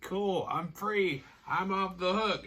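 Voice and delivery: high, drawn-out mock delight; acted elation, pitched well up; stoked. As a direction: play someone gleefully relieved. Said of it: "But she's stoked".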